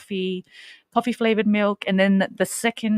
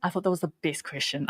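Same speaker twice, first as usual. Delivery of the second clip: ordinary talk, nothing marked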